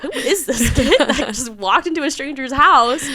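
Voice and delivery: high, loud mock bafflement through laughter; amused disbelief. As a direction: marvel at the odd guest, laughing. Retold bafflement at the young guest.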